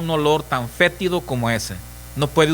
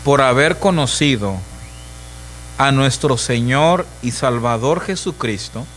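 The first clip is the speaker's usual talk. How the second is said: solemn scripture reading; 3 semitones lower than usual. Acted. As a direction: proclaim the text to the congregation. Preacher reading scripture aloud.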